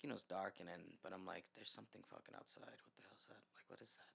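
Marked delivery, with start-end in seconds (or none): 1.5-4.1 very soft whispered alarm; retold paranoid whisper; hushed, spooked. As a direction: whisper it to yourself in the dark. Paranoid muttering at scratching window.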